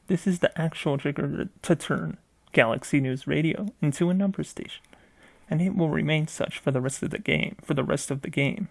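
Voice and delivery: ordinary delivery, nothing marked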